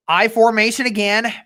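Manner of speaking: steady game commentary; live play-by-play. Acted. Fan streaming the game, calling each play. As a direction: call the action as it happens.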